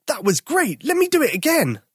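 put-on a boy begging for another go; thrilled. Tom to bears after the boom, excited.

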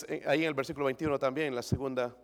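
ordinary delivery

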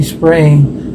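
ordinary talk

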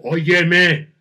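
loud, irritated call. Shown as a demonstration. Teacher demonstrating an irritated tone, loud. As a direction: snap it out, annoyed. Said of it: "Hay acento irritado".

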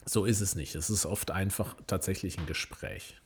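ordinary talk

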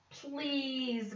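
a soft, high little plea; begging. A narrator's reading. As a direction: coax him with a pleading please. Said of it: "begged the bird".

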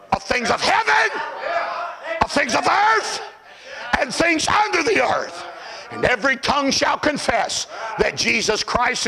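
high, punched-out scripture phrases with pauses; ringing pulpit recitation; emphatic preaching. Acted. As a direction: shout each item of the list separately. Preaching at full force.

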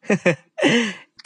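light high chuckle; small chuckle. Acted. Lubai chuckling at Bujang's sudden call. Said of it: "tertawa kecil".

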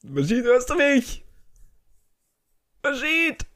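calling out high to a lost connection. Real, not acted. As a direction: call his name across.

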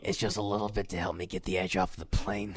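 raspy comic impression; gravelly comedian voice, lightly done; Joey Diaz. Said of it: "cigarette voice like Joey Diaz".

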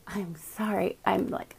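unvoiced whispered apology; whispered sorry. Real, not acted. Streamer whispers an apology while fixing her cord. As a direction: whisper a quick sorry aside.

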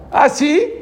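high, sly "oh really?" of a jailer; mocking jailer. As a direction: sneer it like the guard catching them out. Jailer mocks the singing prisoners.

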